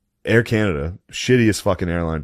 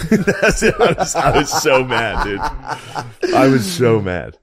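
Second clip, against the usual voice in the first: high-pitched laughing through the words; cracking up over your own anger; helpless laugh, words stretched out; 6 semitones higher, much slower than usual. Real, not acted. Retelling his fight, cracking up.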